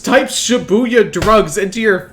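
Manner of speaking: loud, high, exasperated rant; exasperated scolding. Acted. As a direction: chew him out, fed up. Scolding Ryuji for his search.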